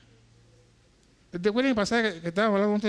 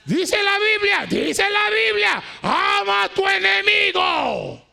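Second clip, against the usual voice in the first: loud, high preaching to the church; full-force preaching; 9 semitones higher, 5 dB louder, slower than usual. Real, not acted. Pastor preaching hard to his church, loud and high. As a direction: thunder it from the pulpit.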